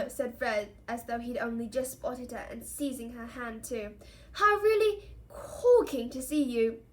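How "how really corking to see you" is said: slow, loud, high mock gushing; hammed-up gentlemanly delight; mock-pompous. A narrator's reading. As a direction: stretch it out with absurd grandeur. Twin keeps up pompous parody.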